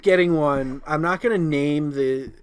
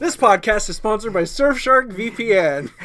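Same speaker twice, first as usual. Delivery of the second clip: mock ad read; 6 semitones higher, 4 dB louder than usual.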